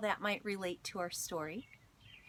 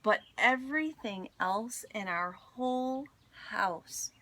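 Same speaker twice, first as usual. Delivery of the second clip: expressive read-aloud storytelling; story narration; 5 dB louder than usual. A narrator's reading. Teacher reading a picture book to her class, expressive storytelling. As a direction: tell it warmly to a class of children.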